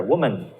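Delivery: "woman" with the second syllable as a weak mən; schwa in woman. Shown as a demonstration. Teacher showing the right "woman" with schwa. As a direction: reduce man to mun. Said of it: "mun over there".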